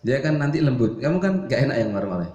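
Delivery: usual speaking voice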